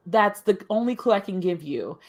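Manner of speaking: usual speaking voice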